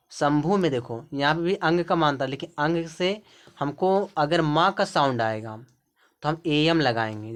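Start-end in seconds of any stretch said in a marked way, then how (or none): none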